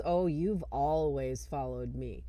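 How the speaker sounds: low, slow, knowing drawl; retold demon's slow taunt. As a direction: draw it out low and smug.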